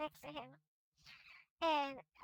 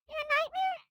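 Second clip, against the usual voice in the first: shocked question; 11 semitones higher, 12 dB louder than usual. Acted. Shocked accusation.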